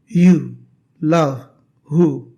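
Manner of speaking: "You", "love", "who" said slowly one by one and leaned on; stressed oo vowels. Shown as a demonstration. Teacher asking students to repeat the vowel sound, each word stressed. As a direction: let "You", "love", "who" resonate in the chest. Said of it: "Try to repeat that".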